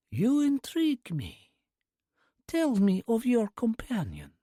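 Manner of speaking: put-on whispered, slow Russian-accented old lady; soft Russian accent. A narrator's reading. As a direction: breathe it like a breeze, Russian accent. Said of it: "the Russian accent undeniable".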